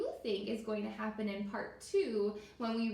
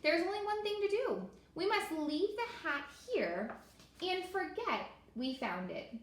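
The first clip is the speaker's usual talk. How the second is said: much higher and fast at first, then calming; resolute turtle; 7 semitones higher than usual. Acted. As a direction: announce the decision, then soften. Turtle makes a decision.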